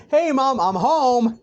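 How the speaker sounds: high, loud singsong greeting; calling out. Acted. Deku coming home through the portal, calling to mom. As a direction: call it through the house.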